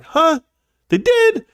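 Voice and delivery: imitating kids' disbelief, squeaking high; kids' surprise. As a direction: play the kids caught off guard. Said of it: "And we were like".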